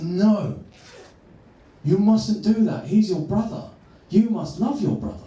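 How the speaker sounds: father stopping son biting brother, stern scolding. Retold or imitated. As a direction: scold the child firmly as the dad. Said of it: "he said it in a stern voice".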